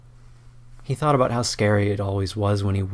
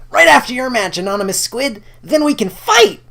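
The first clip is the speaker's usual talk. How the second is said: high, loud and excited; sparkling eager; 9 dB louder than usual. Acted. Lear to Squid, excited about their fight, high and loud. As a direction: burst with eager joy at the coming fight. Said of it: "his eyes sparkling".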